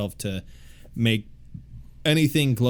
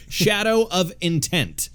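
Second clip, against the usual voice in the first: Shadow stretched high and slow, falling off; a quirky sing-song on "Shadow of Intent"; weird inflection; 8 semitones higher, slower than usual. Shown as a demonstration. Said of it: "I did my weird inflection on that".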